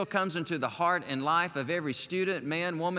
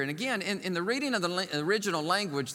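ordinary talk

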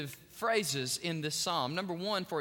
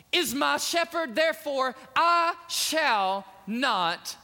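rising, louder, slow proclaiming; declaration; 6 semitones higher, 5 dB louder, slower than usual. Acted. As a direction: proclaim it like a triumphant vow. Preacher declaring psalm at full force.

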